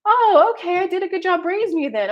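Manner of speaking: high, loud proud mother; proud mom. Retold or imitated. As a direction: play mom beaming with pride.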